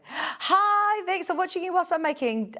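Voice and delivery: high, bright greeting for a viewer's message; cheery message read-out. Retold or imitated. Presenter reads a viewer's message to Vix, cheery and high. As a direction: sing out the hello, pitched high.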